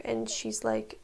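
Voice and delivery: ordinary talk, nothing marked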